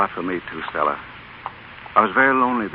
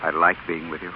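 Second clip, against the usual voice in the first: much softer, lower and intimate; tender; 5 semitones lower, 5 dB quieter than usual. Acted. Stephen assuring Stella he likes her company, softly tender. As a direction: reassure her gently.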